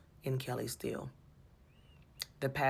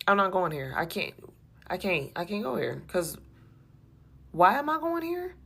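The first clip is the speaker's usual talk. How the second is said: distressed plea to God; 4 semitones higher than usual. Retold or imitated. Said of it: "I'm like".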